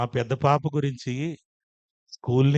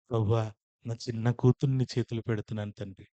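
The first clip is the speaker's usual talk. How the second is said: fervent prayer; 10 semitones higher, 10 dB louder than usual. Retold or imitated. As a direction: pray from a murmur up to a full shout. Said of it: "ప్రార్థన చేశాను".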